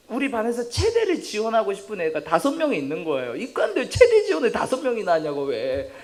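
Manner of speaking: loud, high, laughing incredulity; comic exasperation. Real, not acted. Class president baffled by science-track classmates, comic exasperation. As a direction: protest in mock outrage, laughing.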